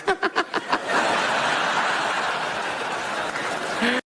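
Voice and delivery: the devil cackling to himself; high breathy cackle. Acted.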